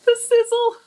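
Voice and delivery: high, loud, thrilled at the sizzle; delighted squeal. Real, not acted. Savoring the griddle sound.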